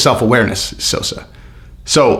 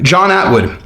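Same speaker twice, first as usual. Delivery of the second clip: usual speaking voice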